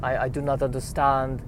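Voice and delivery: halting, put-on French accent. Frenchman giving up on the Dutchman, French accent. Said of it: "It makes my French accent look good".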